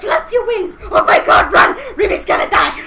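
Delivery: loud, high panicked shouting; frantic yelling. Retold or imitated. Fan urging a character to hurry, frantic shouting. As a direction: yell at him to hurry up. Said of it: "it's like".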